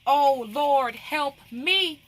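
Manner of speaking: impassioned appeal for help; crying out to God. Acted. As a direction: cry out OH and ME as an acted plea. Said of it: "Let's cry out to God like she did".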